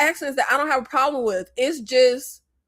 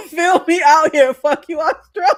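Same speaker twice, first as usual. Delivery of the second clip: higher, louder, words riding on laughter; laughing outburst; 4 semitones higher, 7 dB louder than usual. Real, not acted. Joking jab at Australia, laughing outburst. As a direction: crack up while blurting it out.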